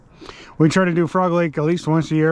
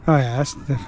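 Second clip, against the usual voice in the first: normal talking voice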